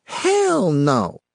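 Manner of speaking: shrill high yell; screaming. A narrator's reading. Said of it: "she reared back and screamed".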